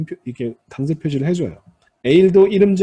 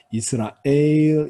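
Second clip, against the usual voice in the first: "이스라엘" with 엘 stressed and held long; final-syllable stress; much slower than usual. Shown as a demonstration. Teacher demonstrating late Hebrew stress, "이스라엘" stressed on the final 엘. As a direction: lean on the 엘 of "이스라엘". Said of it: "강세가 뒤에 옵니다".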